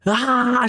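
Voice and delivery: very high mock scream; acted scream. The mother screams as she rips off her face, high shriek. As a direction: shriek in pain. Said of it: "she starts screaming".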